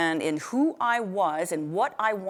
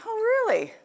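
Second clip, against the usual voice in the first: high sarcastic oh really; a sarcastic retort pitched high; mock surprise; 12 semitones higher than usual. To the trainer, a mock-surprised high oh really.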